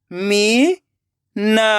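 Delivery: Meena split into मी, long pause, ना; rising मी, then ना alone; syllable by syllable. Shown as a demonstration. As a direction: sound out Meena very slowly in two parts. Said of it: "एक एक अक्षर का उच्चारण करना है".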